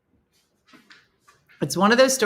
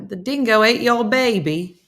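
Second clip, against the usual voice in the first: higher-pitched, bad Australian accent; attempted Australian accent; 6 semitones higher, 4 dB louder than usual. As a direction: try an Australian accent and miss. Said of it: "I don't even know how to sound Australian".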